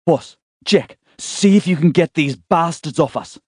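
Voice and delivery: high, loud desperate call; urgent shout. Acted. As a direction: yell over the chaos for help. Dee shouting during attack.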